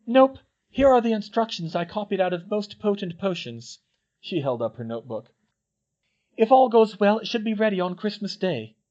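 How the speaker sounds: put-on high girlish voice, proud of her work; a clever schoolgirl boasting; Hermione. A narrator's reading. Hermione explains her still to the twins, voiced high.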